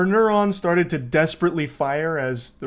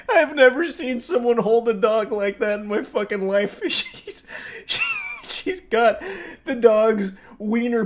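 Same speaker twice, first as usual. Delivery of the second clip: laughing in disbelief; 7 semitones higher than usual. Real, not acted. Laughing at bodycam footage.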